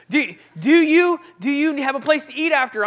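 high, loud, hesitant offer; hammed-up helpful greeter; persistent greeter. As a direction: stumble eagerly into another offer.

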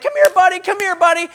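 high, loud call across the road; calling the dog. Retold or imitated. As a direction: call out to a puppy far away.

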